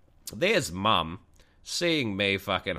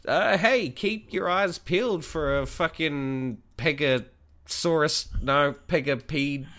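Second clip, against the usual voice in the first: announcer; 9 semitones higher, 4 dB louder, slower than usual. Acted. Hammy outro announcement.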